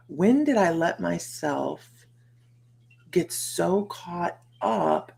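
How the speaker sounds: slow, drawn-out wondering; slowed reflection. Real, not acted. Asking herself slowly how she got this way. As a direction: let each word hang as you realise.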